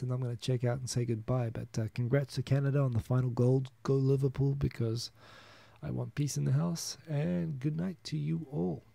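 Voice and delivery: ordinary talk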